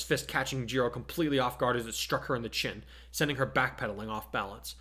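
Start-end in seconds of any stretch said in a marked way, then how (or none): none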